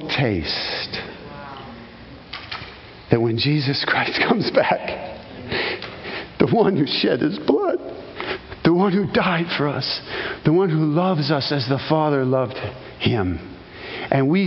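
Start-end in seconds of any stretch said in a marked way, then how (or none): none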